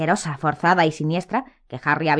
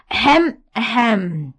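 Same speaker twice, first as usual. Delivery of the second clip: prissy throat clearing; 3 semitones higher, much slower than usual. A narrator's reading. Umbridge's fake cough.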